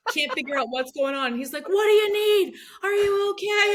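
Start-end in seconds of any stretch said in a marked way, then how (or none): 1.7-3.7 high, loud, urgent call; worried dad shouting. Retold or imitated. Confused dad to his sobbing daughter, alarmed shout.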